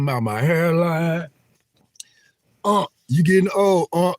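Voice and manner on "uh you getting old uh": high, louder teasing jab; playful mock taunt; mocking chatter. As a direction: needle him like a rude viewer. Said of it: "That was my nickname".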